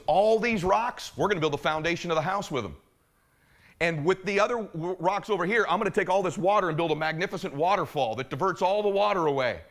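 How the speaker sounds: excited pitch. Acted. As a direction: build up the plan with rising enthusiasm.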